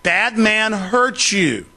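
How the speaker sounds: put-on slow, high, talking down like to a child; mock baby-talk explaining; condescending slow talk. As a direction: spell it out slowly as if to a simpleton. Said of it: "that's how they talk to you".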